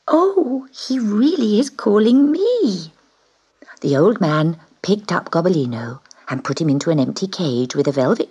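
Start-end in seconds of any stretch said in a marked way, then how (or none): 0.1-2.9 surprised little cat. Acted. Kitten surprised at being called.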